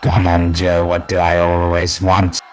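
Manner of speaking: menacing comic-book villain voice; Green Goblin. Acted. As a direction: taunt them like a theatrical supervillain.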